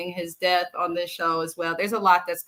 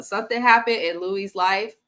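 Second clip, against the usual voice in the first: ordinary talk, nothing marked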